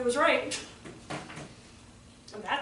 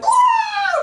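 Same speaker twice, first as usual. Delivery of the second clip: very high, loud mock scream; acted venting scream; 11 dB louder than usual. Teachers letting off steam in the car, acted scream.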